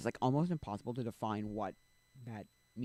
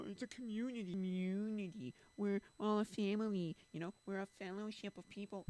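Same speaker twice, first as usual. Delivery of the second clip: mocking baloney talk; 9 semitones higher than usual. Speaker mimicking church people's community talk, high mocking voice. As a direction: stretch the buzzwords in a put-on high voice. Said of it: "big baloney words of, like".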